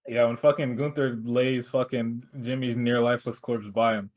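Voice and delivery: usual speaking voice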